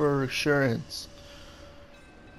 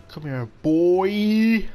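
slow call, louder and higher on the end; playful taunting call; 4 semitones higher, 5 dB louder, much slower than usual. Real, not acted. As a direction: stretch it out as you go after the ball. Calling to the ball in play.

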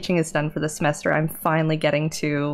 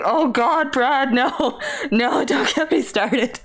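laughing exasperation; 6 semitones higher, slower than usual. Real, not acted. Laughing exasperation about vendor.

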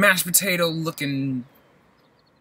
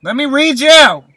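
high loud call out; loud mock announcement; 10 semitones higher, 15 dB louder than usual. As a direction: proclaim it grandly. Announces reading tag loudly.

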